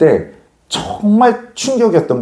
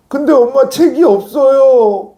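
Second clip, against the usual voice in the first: high, drawn-out plaintive whine; hammed-up tearful complaint, slow and high; mock whining child; 9 semitones higher, slower than usual. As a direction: imitate a kid wailing to a parent. Child wailing to mother about books.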